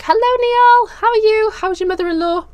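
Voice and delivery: high, bright hello to a regular viewer; light up and call out hello; bubbly greeting. Real, not acted.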